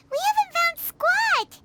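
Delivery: whiny complaint. Acted. Paimon complaining to Alhaitham, high whiny protest. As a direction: moan about the fruitless search.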